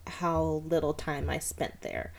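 usual speaking voice

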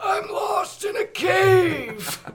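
very high, drawn-out strained cry; slow, straining villain wail far above normal pitch; Megatron voice warm-up. Shown as a demonstration. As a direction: stretch it into a loud, pained screech. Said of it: "explains how he came up with the voice".

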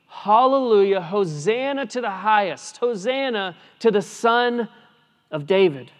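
Hallelujah and Hosanna cried high and loud; joyful proclaiming. Shown as a demonstration. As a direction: proclaim it like the children in the temple. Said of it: "Children proclaiming".

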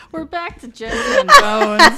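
laughing it out, high and loud; breaking into laughter mid-line. Real, not acted. Laughing about the returning characters.

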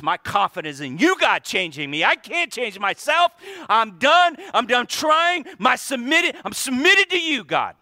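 high, loud, pleading surrender to God; shouted plea ending in surrender; prayer of surrender. Pretend. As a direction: cry out giving up to God. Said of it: "Or Philippians one six".

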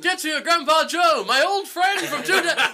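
high, straining mock-dramatic voice; hammed-up old comrade. Grandpa's army friend calls to Charlie, mock-heroic cry. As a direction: declaim it like a war buddy's big speech.

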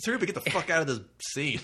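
loud, high mock dismissal; fed-up writer. Writer tired of his own dialogue, acted fed-up outburst. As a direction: throw it out in exasperation.

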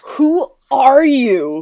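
drawn-out mock who are you; mock surprise. Mock not knowing each other.

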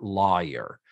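"lawyer" drawn out in a Texas accent; slow Southern "lawyer". Shown as a demonstration. Host mimics the actress's Texas "lawyer", slowly. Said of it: "That was full on Lisa Welch, Texas".

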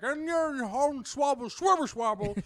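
put-on high, slow, cackly old prospector drawl. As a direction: play a rambling old prospector. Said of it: "prospector's like".

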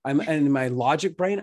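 ordinary delivery

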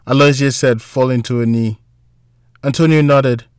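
usual speaking voice

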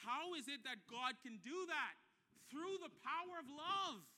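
loud, high, rousing sermon delivery; rally the church with a passionate call; full-force preaching. Real, not acted. Preacher's closing challenge.

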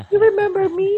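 surprised teacher. Retold or imitated. Teacher meeting a grown student, high mock delighted question. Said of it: "I'm like".

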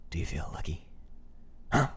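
low, soft, threatening whisper; menacing whisper. Retold or imitated. Finishing the Dirty Harry quote for his cohost, low menacing whisper. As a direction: lean in and threaten quietly.

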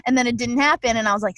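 ordinary delivery

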